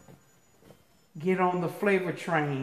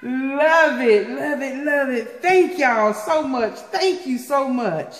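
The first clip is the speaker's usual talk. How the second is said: higher-pitched, excited gushing; delighted thanks; 5 semitones higher, 3 dB louder than usual. Real, not acted. Vlogger thanking senders for the shirts, excited gushing. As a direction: gush with real delight, pitched up.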